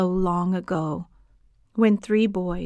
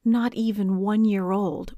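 plain reading voice

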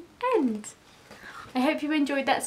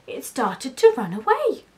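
usual speaking voice

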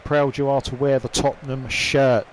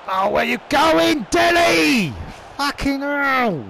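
much higher, louder shout, slow angry "fucking hell" at the end; exasperated shout; 10 semitones higher, 4 dB louder, slower than usual. Real, not acted. Gamer shouting at Dele for his mistake, exasperated shout. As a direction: scream at Dele for wandering off.

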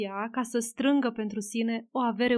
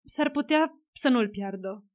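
usual speaking voice

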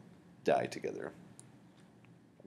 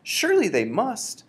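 cried out; 13 semitones higher, 7 dB louder than usual. Acted. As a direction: beg her to say it isn't so. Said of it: "I cried".

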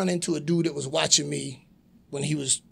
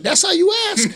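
high, loud outraged question; indignant; 8 dB louder than usual. Retold or imitated. Wrestler scolding a kid wanting an autograph, loud indignation. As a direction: recoil in mock outrage. Heel rebukes child autograph seeker.

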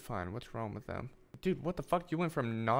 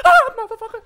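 high gasp into a loud yell of fright; real jump-scare shriek; startled scream; 18 dB louder than usual. Real, not acted. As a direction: jolt and scream.

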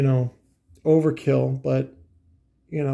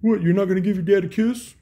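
lightly voiced higher dad; a hint of a pushy father; imitating dad; 6 semitones higher, 3 dB louder than usual. Retelling his father at the truck, lightly mimicked dad voice. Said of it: "he's like".

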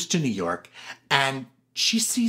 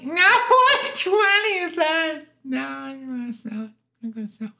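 Gladys Glover voice; 10 semitones higher, 3 dB louder than usual. Retold or imitated. Gladys refusing twenty for the billboard, high protesting squeal. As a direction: protest in a piercing mock-Gladys voice.

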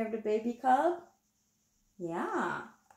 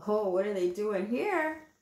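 usual speaking voice

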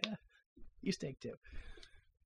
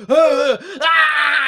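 a high, loud pained "ah, ah" while clutching his head; mock anguished cry; 8 semitones higher, 8 dB louder than usual. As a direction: cry out as if a helicopter drove you mad. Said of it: "and then he's like".

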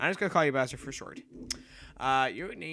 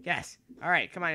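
ordinary delivery

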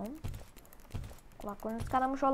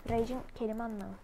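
ordinary talk, nothing marked

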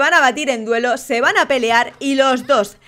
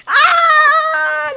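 long high-pitched scream; startled scream; 12 semitones higher, 4 dB louder than usual. Real, not acted. Host sees the first death, startled scream. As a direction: scream out in shock, held long. Game piece bursts, player lost.

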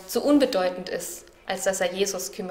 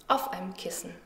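hushed whisper; 2 semitones lower, 8 dB quieter than usual. A narrator's reading. Jesus sleeping in the boat.

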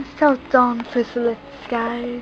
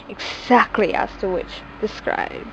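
plain reading voice

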